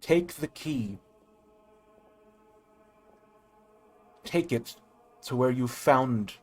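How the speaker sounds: slow, grave oracle; solemn spirit voice. Acted. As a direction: intone it like an ancient guide. GM voices mysterious guide.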